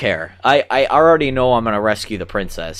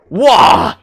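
loud, high villain yell; villain's roar; 9 semitones higher, 10 dB louder than usual. Acted. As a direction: burst out with a big "wah". Voicing Bowser's dialogue in game.

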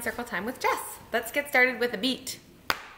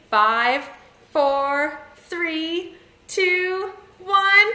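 each number stepping higher; rising countdown; 5 semitones higher, 5 dB louder, much slower than usual. Shown as a demonstration. Leader counting children down to launch, rising. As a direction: build suspense number by number, climbing in pitch.

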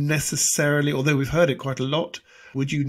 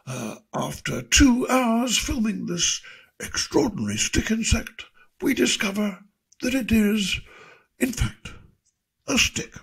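higher, slow, hushed documentary narration; David Attenborough impression; 8 semitones higher than usual. Nature presenter revealing a stick insect, hushed and slow. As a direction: build the reveal slowly. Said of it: "As I say".

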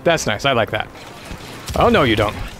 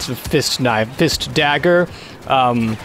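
usual speaking voice

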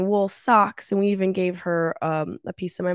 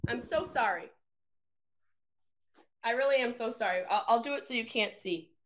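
apologetic aside; 4 semitones higher, 12 dB quieter than usual. Real, not acted. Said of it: "Let me just blow my nose".

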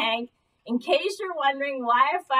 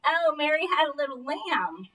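ordinary talk, nothing marked

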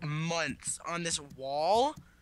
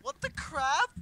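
surprised; 13 semitones higher than usual. Real, not acted. Confused at game day/night.

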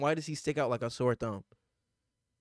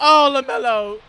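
loud hype call; 8 semitones higher, 12 dB louder than usual. Real, not acted. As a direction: belt the name like a hype man. Calls out player name repeated.

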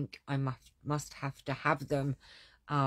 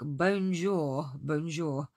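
slow, louder mock-French bonjour like Del Boy; 4 dB louder, slower than usual. Crafter imitating Del Boy's French for viewers, slow and hammy. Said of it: "Del Trotter, that's what I was trying to say".

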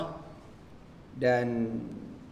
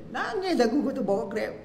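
shocked; 8 semitones higher than usual. Retold or imitated. Shocked friend drives Grab.

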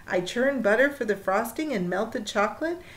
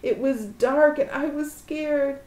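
plain reading voice

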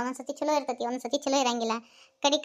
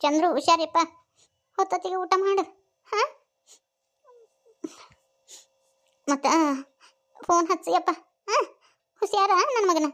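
higher, halting tearful farewell; mother's choked-up goodbye, pitched up; 5 semitones higher than usual. Acted. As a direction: send a son off while holding back tears. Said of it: "ನೀನ್ ಆಳಾಕತ್ತಿಲ್ಲ".

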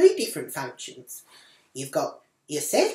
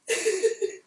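high gleeful giggle. Real, not acted. Laugh at Leslie.

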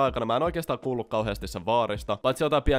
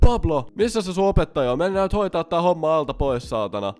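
loud, high, demanding yell; drunk grandpa yelling; 6 semitones higher, 6 dB louder than usual. Retold or imitated. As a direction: bark it out like a drunk grandpa storming in. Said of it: "se huusi saman tien jotain".

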